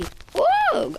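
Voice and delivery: high excited whoop; excited squeal. Real, not acted. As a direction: squeal with delight. Reacting in game.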